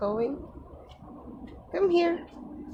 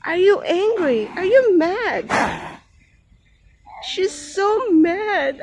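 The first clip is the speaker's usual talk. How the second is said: pet talk; 6 semitones higher, 7 dB louder than usual. Real, not acted. Talking to the cat.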